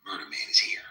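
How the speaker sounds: low whispered villain boast; evil villain voice. Retold or imitated. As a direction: hiss it like a comic-book villain. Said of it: "he might put a little evil villain voice".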